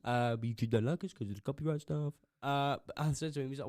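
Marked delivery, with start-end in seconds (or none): 0.0-2.1 mock promo plug.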